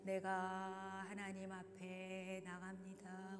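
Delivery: very slow, even, prayerful; slow intoned prayer. Acted. Guiding worshippers toward God, very slow intoned line. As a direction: stretch every syllable like a chant.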